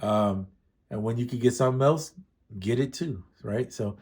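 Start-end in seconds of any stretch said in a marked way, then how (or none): none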